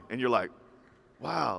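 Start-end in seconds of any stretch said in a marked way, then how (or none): 1.2-1.6 soft, hushed little wow; understated awe. Acted. Listener reacting to a confident answer, understated awe. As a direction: react softly, as if quietly impressed.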